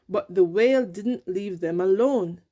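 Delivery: ordinary delivery, nothing marked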